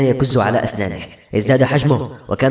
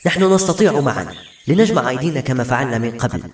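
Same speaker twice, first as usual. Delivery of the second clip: usual speaking voice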